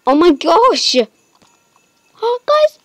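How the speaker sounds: high, loud joyful cries; excited squeal. Real, not acted. Telling viewers about the new horse, squealing with joy. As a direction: call out to the viewers in delight.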